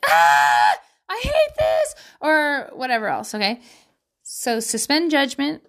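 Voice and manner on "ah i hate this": put-on loud childish yell jumping high on hate; mock tantrum. Parent imitating her son's birthday tantrum, loud mock yell. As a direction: act out a kid's meltdown. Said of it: "he freaked out and was like".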